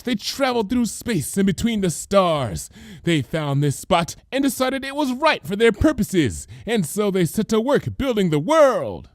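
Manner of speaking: high, theatrical conspiracy voice; hammed-up grand declaration; dramatic cry. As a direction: proclaim it like a caped prophet. Lyra's alien history lecture.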